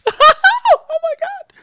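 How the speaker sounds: put-on a slapped child putting on a show; mock wail. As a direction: fake a child's dramatic crying fit. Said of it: "you have a crying episode".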